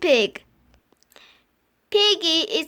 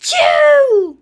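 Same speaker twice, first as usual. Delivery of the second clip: loud explosive sneeze; big sneeze; 7 semitones higher, 10 dB louder than usual. Acted. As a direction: let the sneeze burst.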